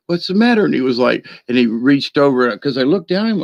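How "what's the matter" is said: talking to the dog. Retold or imitated. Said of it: "I look at him and I go".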